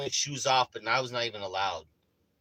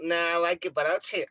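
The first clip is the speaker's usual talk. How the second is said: raised, louder teasing verdict; mocking mom's taste; 6 semitones higher, 6 dB louder than usual. Teasing mom about the remodel, loud and scornful. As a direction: judge the decor with playful scorn. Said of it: "I'd be like".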